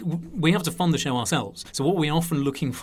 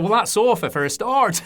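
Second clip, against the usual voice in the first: loud, high Lancashire punchline; animated impression of a Lancashire man; Lancashire accent; 7 semitones higher, 6 dB louder than usual. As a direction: deliver the punchline in broad Lancashire, raised. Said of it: "So it's my Lancashire accent there".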